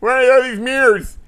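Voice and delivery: high, loud, slow cartoon bewilderment; hammed-up dazed shout; silly cartoon Superman. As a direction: stretch it out like a dim hero. Voicing a confused Superman in the skit.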